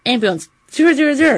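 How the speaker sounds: high, rushed emergency reflex; mock alarm. Social worker jumping to call triple zero, mock alarm. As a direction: imitate an overreacting alarm, fast and high.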